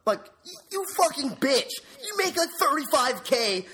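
high-pitched indignant outburst; pissed off. Real, not acted. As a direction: spit insults in worked-up outrage. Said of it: "so fucking pissed off".

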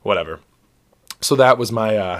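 ordinary talk

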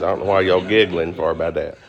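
normal talking voice

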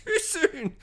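high, drawn-out comic whine; laugh, then squeak it out slowly; mock hurt. Jokey hurt reply to teasing.

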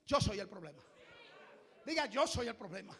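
low, soft model phrase; quiet confession. Shown as a demonstration. Pastor quietly modeling confession for congregation. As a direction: murmur it as a confession. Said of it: "diga usted mismo".

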